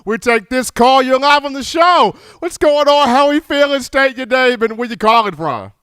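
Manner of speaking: upbeat, punchy call-in host intro; radio host hype. Acted. Radio host greeting a new caller, upbeat and punchy. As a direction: pump up the next caller as you bring them on air.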